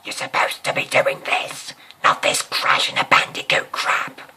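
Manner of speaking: very loud scolding yell; teacher screaming over the Crash Bandicoot music; angry shout. Retold or imitated. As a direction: tear into the pupil. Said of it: "the teacher goes".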